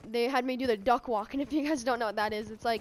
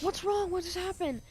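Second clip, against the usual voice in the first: alarmed parents; 7 semitones higher than usual. Retold or imitated. Parents running in to ask what happened, alarmed. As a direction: imitate worried parents in a higher voice.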